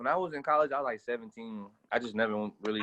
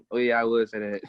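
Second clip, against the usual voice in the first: ordinary delivery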